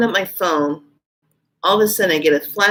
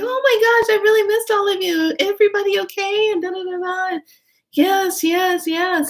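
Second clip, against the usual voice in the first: high, gushing reunion voice; retold delight, pitched way up; excited greeting; 13 semitones higher than usual. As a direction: act out a squealy hello to old friends.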